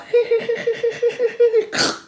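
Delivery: high stuttering starter noise; failing engine imitation. Shown as a demonstration. Yet another failed attempt, high stuttering engine imitation. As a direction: crank like a car that won't start. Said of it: "donc encore une fois".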